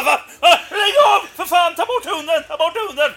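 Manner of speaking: high, very loud frantic shouting; hammed-up alarm, screaming in protest; retold panicked yelling. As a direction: yell for the dog to be pulled off. Neighbour bitten by dog, acted out.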